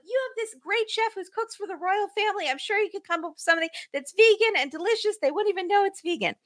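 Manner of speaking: high, distant whining impression; Jasmine. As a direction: plead in a put-on high voice, off the mic. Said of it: "sounded like Jasmine was in another room".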